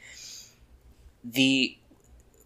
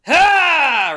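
cheering shout; 9 dB louder than usual. Acted. Crowd cheers.

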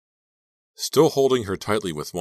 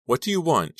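high, somewhat loud question; tense, raised-pitch demand; anxious question; 11 semitones higher, 5 dB louder than usual. Acted. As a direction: ask anxiously, short of a full cry. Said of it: "she asked".

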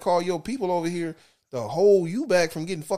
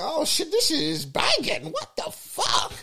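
amazed; 10 semitones higher, slower than usual. Retold or imitated. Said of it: "I'm like".

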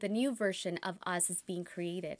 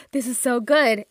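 blown away; 3 semitones higher, 14 dB louder than usual. Retold or imitated. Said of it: "I was like".

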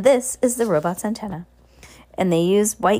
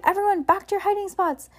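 urgent, very high-pitched call; excited cry; 13 semitones higher than usual. Acted. Heidi sending guests back to hide, crying out. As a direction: squeal the order to the room. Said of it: "she cried".

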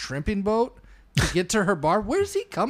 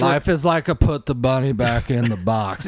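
put-on Southern movie accent; 7 semitones lower than usual. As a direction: lay on a bad Southern accent, pitched low. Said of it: "Is Forrest Gump better without the accent?".

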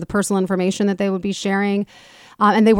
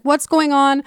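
mock panic; 7 semitones higher, slower than usual. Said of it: "[name] is panicking".

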